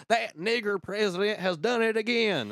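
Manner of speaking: high, loud mock rant; outraged commenter. Co-host mimicking Yahoo's elderly comment section, high mocking outrage. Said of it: "the whole comment section is like".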